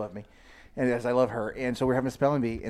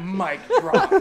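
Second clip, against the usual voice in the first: triumphant quip; 8 semitones higher, 7 dB louder than usual. Retold or imitated. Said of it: "And I was like".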